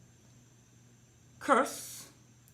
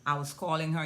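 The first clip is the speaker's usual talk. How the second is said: ordinary delivery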